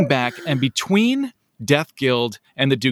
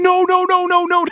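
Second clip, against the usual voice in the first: loud, high, rapid-repeat mock alarm; retold frantic high yell; mock panicked shout; 6 dB louder than usual. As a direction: cry out as if stopping a disaster, played for laughs. Stops someone drinking motor oil.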